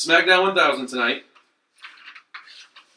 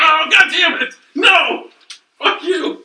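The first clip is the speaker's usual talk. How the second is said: high, loud, hammed-up outrage; mock furious shout; 13 semitones higher, 7 dB louder, slower than usual. Reacting to the fake Meltzer reveal, loud mock fury. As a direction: yell like you've been fooled.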